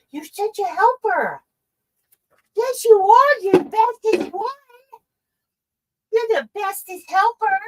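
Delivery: high-pitched pet praise. Real, not acted. Praising the dog in baby talk.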